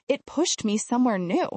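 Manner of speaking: usual speaking voice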